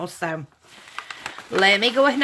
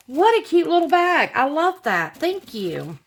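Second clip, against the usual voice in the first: gushing delight; 7 semitones higher, 3 dB louder than usual. Real, not acted. Gushing over handmade gift.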